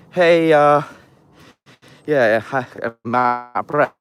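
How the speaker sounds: out of breath. Real, not acted. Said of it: "Very out of breath".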